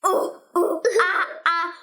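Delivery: playful ooh ooh ah ah calls with a laugh; monkey hoots. Pretend. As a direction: hoot like a giggly monkey. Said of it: "I think she still sounds like this".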